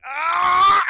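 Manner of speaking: high, strained scream of exasperation; Shaquille exasperated scream. Retold or imitated. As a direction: let out a mock enraged "aah". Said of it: "Shaquille would get so mad".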